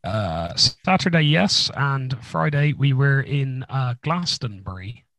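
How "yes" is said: ordinary delivery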